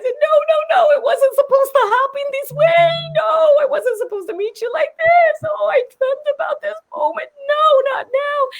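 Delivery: very high, hammed-up wailing; mock-sob in a squealing high voice; retold crying. Flustered fan meeting her idol, retold crying wail. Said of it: "and I started crying".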